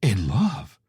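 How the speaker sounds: gasping surprise. Acted. Mother shocked by son's confession, gasping. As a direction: gasp the words in disbelief. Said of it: "Mom gasps".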